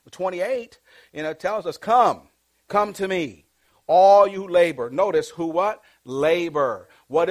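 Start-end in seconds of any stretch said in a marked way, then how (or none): 1.8-4.9 preached scripture. Acted.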